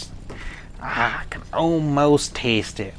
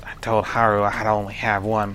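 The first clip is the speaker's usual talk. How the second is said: ordinary talk, nothing marked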